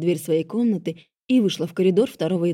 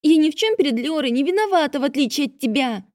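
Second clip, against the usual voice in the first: put-on young girl voice; 7 semitones higher than usual. A narrator's reading. Half-sister voiced in narration.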